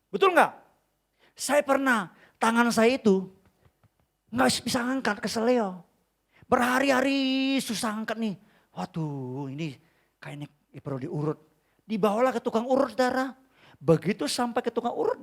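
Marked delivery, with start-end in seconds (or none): none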